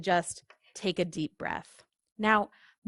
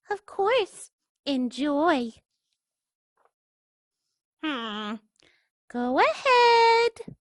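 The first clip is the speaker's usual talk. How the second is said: higher, slow polite duckling voice; sweet duckling; 7 semitones higher, 5 dB louder, much slower than usual. Acted. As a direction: politely nudge the pigeon.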